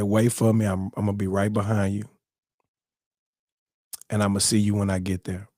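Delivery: low, soft, tender farewell; calm promise. Retold or imitated. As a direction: imitate a composed grandfather's goodbye. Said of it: "the man was so calm".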